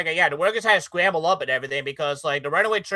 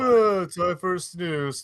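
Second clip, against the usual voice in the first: sleepy voice; much slower than usual. Retold or imitated. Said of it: "let's not forget this".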